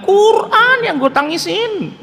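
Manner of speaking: high, slow, laughing reply; laughing mock insistence. Insistent retold answer, laughing. (menjawab ngotot sambil tertawa)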